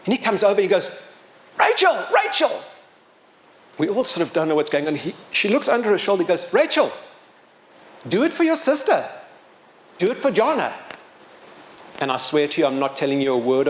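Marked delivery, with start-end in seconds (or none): 1.6-2.5 high-pitched imitation of a kid calling out; imitated high, urgent call; retold yell. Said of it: "there's this yell that comes from the side". 6.5-10.7 high-pitched urging, as if calling up a tower; retold kid's rallying call, pitched high; imitated yelling. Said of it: "there's this yell that comes from the side".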